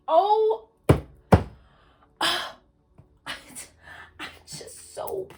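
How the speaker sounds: high gasp into breathless whisper; overwhelmed, gasp then whisper; amazed gasp. Real, not acted. Reader overwhelmed as a card repeats, gasping in awe.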